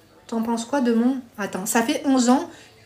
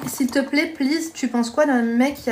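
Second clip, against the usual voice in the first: ordinary talk, nothing marked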